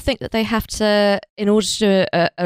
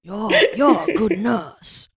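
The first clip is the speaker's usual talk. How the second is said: hammed-up southern US accent, drawn out slowly; slow mock southern drawl; 3 semitones higher, slower than usual. Showing the others a film star's southern accent, slow mock southern. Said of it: "do a, a southern accent of some kind".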